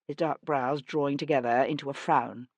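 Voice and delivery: ordinary delivery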